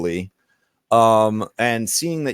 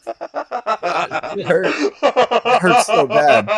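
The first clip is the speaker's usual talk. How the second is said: helpless laughter; 8 semitones higher, much slower than usual. Real, not acted. Game master pained by the accent joke, laughing helplessly. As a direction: crack up while getting the words out.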